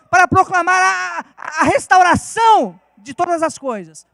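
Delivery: building to a loud preaching shout; preaching at full force. Acted. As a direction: proclaim it over the congregation, rising.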